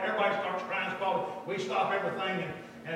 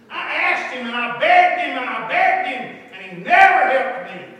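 much louder, higher protest; retold anguished outcry; 6 semitones higher, 16 dB louder, slower than usual. Abused girl to preacher, retold loud anguish. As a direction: belt out her grievance.

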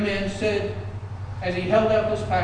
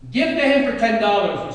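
loud, higher impatient call; shouted out; 4 semitones higher, 7 dB louder than usual. Acted. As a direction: shout it to end the sale. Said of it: "he said, shouted out".

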